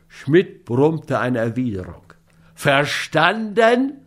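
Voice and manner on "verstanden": VERSTANDEN drawn right out, rising high; mock thundering demand. A narrator's reading. Scott repeats his question to grumbling Schmidt, understated thunder. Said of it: "donnerte der andere ihn an".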